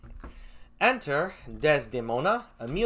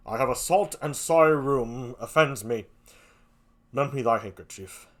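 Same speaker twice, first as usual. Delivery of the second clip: a lower brooding Moor testing her hand; Othello; 3 semitones lower than usual. Acted. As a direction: Othello probing his wife, low and pointed. Othello voiced in play reading.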